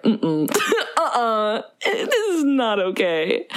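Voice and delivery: slow, laughing no-no; laughing mock disapproval. Hearing her old [name] recording, slow laughing protest. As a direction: wag a finger, laughing slowly. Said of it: "I was like".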